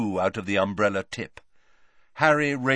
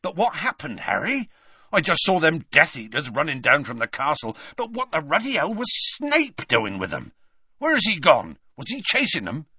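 raised, urgent questioning; puzzled, agitated Hagrid; 5 semitones higher than usual. A narrator's reading. Hagrid asking Harry about Snape and Death Eaters, agitated. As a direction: fire off bewildered questions, pitched up.

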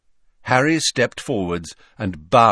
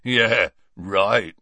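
scornful, dismissive snort; scoffing Hagrid; 4 dB louder than usual. A narrator's reading. Hagrid disbelieving his visitors, scoffing. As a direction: scoff and brush it off. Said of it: "snorted Hagrid".

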